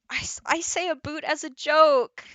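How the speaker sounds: loud, high-pitched protest at being teased; indignant protest. Real, not acted. Protesting being made fun of.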